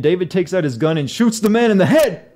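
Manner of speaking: incredulous, loud reading of a plot twist; shocked disbelief. Real, not acted.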